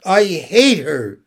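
put-on a grieving boy sobbing to his father; through sobs. A narrator's reading. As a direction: force the words out between sobs. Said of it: "Jess said through his sobs".

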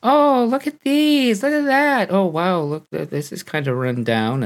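mock dazzled driver. Mimicking a driver admiring scenery.

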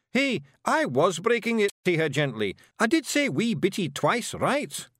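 high, loud little Scots warrior; indignant Feegle defending himself; Scottish Feegle, protesting. A narrator's reading. Voices Rob, a Feegle.

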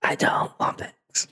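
slow, soft whispered refusal; mock whisper. Customer answering the fake cashier, slow mock whisper. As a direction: whisper it slowly as a comeback.

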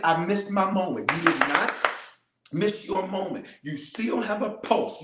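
ordinary talk, nothing marked

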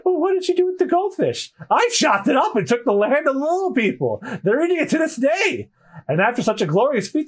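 high-pitched, boastful character voice; excited young storyteller. Acted. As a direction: tell the tall tale way up high.

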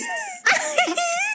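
squealing high laugh; a high embarrassed squeal of laughter. Real, not acted. High squeal of laughter, embarrassed by the story.

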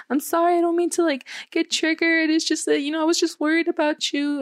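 high-pitched, lightly tearful imitation; pleading retold apology, pitched well up. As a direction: act out apologising through tears, without really crying. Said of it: "Like, I started crying".

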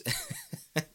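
high short laugh at himself; quick amused chuckle. Real, not acted. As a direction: laugh off the rant. Laughs at own ranting.

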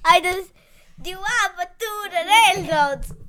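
loud, hammed-up Italian accent; playful fake Italian; put-on Italian accent. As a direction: ham up a mock Italian accent. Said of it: "now we're using the Italian accent".

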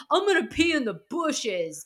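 mock him like a sloppy drunk guy; mock drunk Kyle. Drunk Kyle quoted mockingly.

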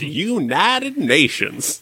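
slow, stretched mock Southern drawl; imitated Southern accent, very drawn out. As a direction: draw out "United Nations" with a Southern twang. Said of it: "develops a Southern drawl for those two words".

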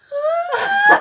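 a sudden high, loud burst of laughter. Real, not acted. Laughing hard while retelling to a friend, laughing.

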